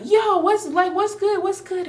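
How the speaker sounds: high, whiny imitation of a clueless guy; a high mock voice for her boyfriend's lame excuse. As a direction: mimic him sounding confused. Mocking the boyfriend's confused reply.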